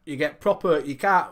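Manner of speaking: ordinary talk, nothing marked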